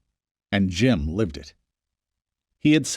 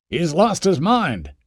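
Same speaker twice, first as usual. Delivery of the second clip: slow, mildly raised call from the crowd; yelled back; 7 semitones higher, slower than usual. Acted. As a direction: call it back across the street, a little raised. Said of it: "somebody yelled back at her".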